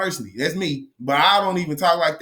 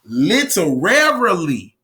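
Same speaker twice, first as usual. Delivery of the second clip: slow, louder "Literarily"; emphatic stress; 4 dB louder, slower than usual. Shown as a demonstration. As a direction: drag out "Literarily" to drive it home. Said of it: "I gotta keep emphasizing that".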